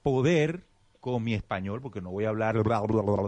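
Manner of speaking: ordinary talk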